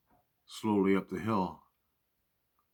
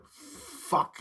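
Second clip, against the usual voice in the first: startled curse. Real, not acted. Bear bursts out of bush.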